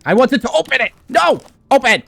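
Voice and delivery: high exasperated shout; yelling at the game; frustrated yell. Real, not acted.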